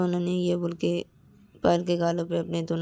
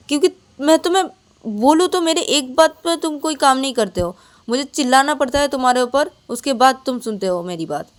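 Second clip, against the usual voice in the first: much higher, louder scolding; angry retort; 7 semitones higher, 9 dB louder than usual. Acted. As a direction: let the irritation burst out. Payal snaps back.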